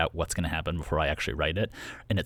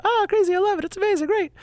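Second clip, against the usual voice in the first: very high, loud squealing delight; giddy excitement; 8 dB louder than usual. Retold or imitated. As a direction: squeal like an overjoyed cartoon fan. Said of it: "that's my voice that I pick".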